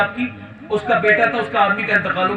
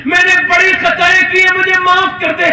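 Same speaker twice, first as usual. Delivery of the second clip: rising, louder desperate cry for forgiveness; sobbing plea; 7 semitones higher, 6 dB louder than usual. A narrator's reading. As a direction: plead to God between final sobs, climbing higher and louder. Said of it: "آخری سچکیاں لے کے کہتا ہے". (ہچکیوں کے ساتھ بلند ہوتی ہوئی التجا)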